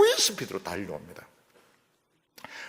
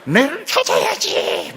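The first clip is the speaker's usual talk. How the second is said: put-on loud, slow, sulky child's yell; angry child; 3 semitones higher, 12 dB louder, slower than usual. Upset child yelling at busy mom to come find him. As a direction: demand to be found, stamping. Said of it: "막 화가 나가지고".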